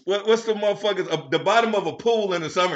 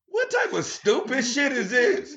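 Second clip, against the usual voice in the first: higher, slow, mock disbelief; incredulous; 5 semitones higher than usual. Recalling the stud with the condom, slow disbelief. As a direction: spell out the outrage, drawn out. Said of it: "and I was like".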